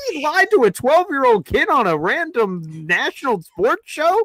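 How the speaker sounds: high-pitched disbelief; hammed-up outraged astonishment; incredulous outburst. Re-enacting disbelief at the lie.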